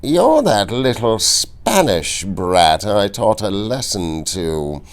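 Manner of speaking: lightly voiced sneering count; slow, smug villain's taunt; Count Rugen. A narrator's reading. The Count sneering at Inigo, slow and mocking. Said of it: "he said, coming closer now".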